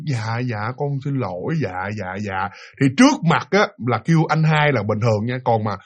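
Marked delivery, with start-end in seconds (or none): 0.1-2.5 soft, lower, submissive murmur; meek yes-yes. Retold or imitated. Eldest son meekly accepting his father's scolding, soft and low. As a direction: answer meekly, eyes down.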